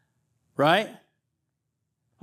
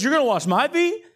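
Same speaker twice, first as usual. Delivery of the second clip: you can't be serious, Lord; incredulous Peter; 9 semitones higher than usual. Acted. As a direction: Peter refusing the washing in disbelief. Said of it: "And so he says".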